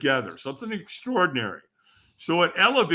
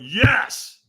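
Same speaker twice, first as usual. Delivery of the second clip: YES louder and higher; stronger yes; 6 semitones higher, 5 dB louder than usual. Retold or imitated. Firewalker answering trainer's demand, forceful yes. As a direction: put more force behind YES.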